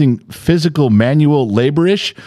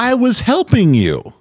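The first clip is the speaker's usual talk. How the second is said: exasperated protest; 8 semitones higher than usual. Real, not acted. Husband protests again, exasperated.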